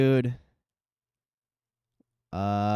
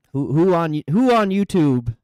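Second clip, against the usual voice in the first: pitched up and loud, all fired up; excited outburst; 6 semitones higher, 8 dB louder than usual. Real, not acted. Streamer hyping his trading community to chat, excited loud outburst. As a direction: shout the question out to chat with real hype.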